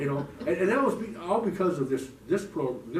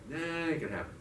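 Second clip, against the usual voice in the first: higher, offhand brush-off; casual, pitched-up no; dismissive refusal; 6 semitones higher than usual. Retold or imitated. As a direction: wave the order away. Refusing the voice in his head.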